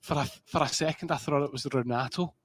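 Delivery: ordinary talk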